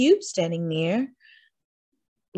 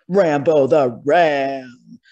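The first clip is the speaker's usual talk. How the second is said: slow, loud and weighty; dramatic booming announcement; 5 semitones lower, 7 dB louder, slower than usual. A narrator's reading. Narrator announcing the ram to listening children, slow and booming. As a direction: boom out the name like a fanfare. Narrator booms the ram's name.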